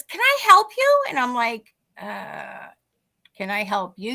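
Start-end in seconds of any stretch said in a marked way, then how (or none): 0.0-1.0 high, loud, singsong offer of help; much higher, louder acted question; imitated chirpy stranger. Stranger at the car window. 2.0-4.1 low, hesitant acted comeback; lower, softer retold question after a drawn-out uh; puzzled reply. Startled teller answers the stranger.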